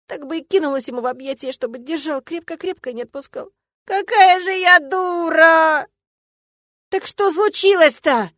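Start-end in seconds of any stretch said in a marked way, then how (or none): 3.8-5.9 loud, high, slow tearful howl; stretched self-pitying cry; wailing. A narrator's reading. Heroine wails self-reproach again. 6.9-8.3 loud, high, drawn-out question; worried outcry; alarmed exclamation. A narrator's reading. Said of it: "воскликнула уже с испугом Стелла".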